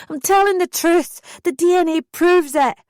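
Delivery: high loud desperate shout; screaming. A narrator's reading. Daughter screaming the truth down the phone at mum, high loud shout. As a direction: keep screaming the truth at her. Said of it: "I screamed back".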